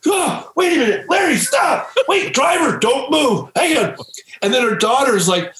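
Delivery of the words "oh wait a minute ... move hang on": high, frantic shouting; panicked shout. Retold or imitated. Producer shouts to stop Larry and the driver, frantic. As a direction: yell to stop everyone leaving at once. Narrator retelling his own panic.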